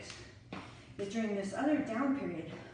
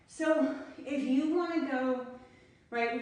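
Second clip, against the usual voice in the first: winded; 5 semitones higher, 6 dB louder, slower than usual. Real, not acted. Out of breath after interval.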